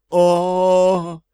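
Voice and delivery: high, drawn-out languid moan. Acted. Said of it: "томно простонала принцесса".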